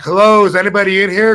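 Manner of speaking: calling out. Retold or imitated. Rob calls into the dark closed restaurant, loudly. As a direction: call into the empty room, checking.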